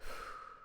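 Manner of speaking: soft unvoiced sigh, bored and resigned; long quiet sigh. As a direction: let out a long, weary breath. Captive bored after going quiet.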